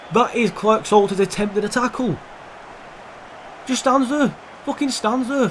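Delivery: high, frustrated disbelief; exasperated rant. Real, not acted. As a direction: vent at the useless defender. Ranting at defender.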